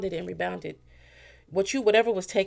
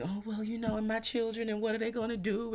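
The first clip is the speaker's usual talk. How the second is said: put-on higher, softer whiny plea about the children; lightly hammed-up needy voice, higher and softer; mock pitiful plea; 7 semitones higher, 7 dB quieter than usual. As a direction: ham up a guilt trip. Manipulator plays up the kids.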